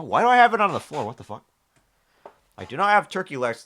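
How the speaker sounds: ordinary talk, nothing marked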